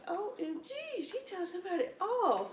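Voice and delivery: high-pitched gossipy amazement; mock shock. Customer overhearing nail techs' chatter, mock shock. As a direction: react in scandalised, playful disbelief. Retold shock, second time.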